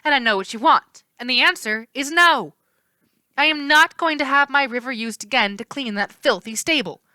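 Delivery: high, loud, hard refusal; furious naiad defending her river; punching with words. A narrator's reading. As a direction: hit him with every word. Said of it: "punching me with words".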